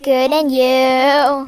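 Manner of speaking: very slow, stretched words; drawn out. Pretend. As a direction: drag it out playfully. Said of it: "We are stretching this".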